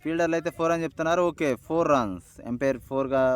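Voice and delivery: lively high play-by-play call; excited cricket commentary. Real, not acted. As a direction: call the shot as it happens.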